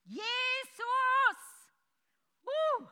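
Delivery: high, loud cry of "Jesus!"; desperate shout to Jesus; blind man's cry. Acted. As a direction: scream out for Jesus like the blind man. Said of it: "Er hat geschrien".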